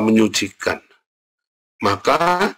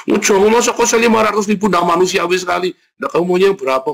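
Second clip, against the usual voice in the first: high, loud indignant objection; mock protest; 8 semitones higher, 5 dB louder, faster than usual. Preacher acting out a boarding-house haggle, loud high protest. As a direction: act out an outraged haggler. Acted haggling over rent money.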